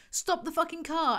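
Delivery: "Stop the fucking car" thrown up high; hammed-up yell of the line; mock bellow. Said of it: "I bellowed with the full force of my lungs".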